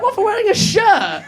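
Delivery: high, loud, drawn-out disbelief; mock incredulity. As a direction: act out an outraged retort. Own incredulous retort acted out.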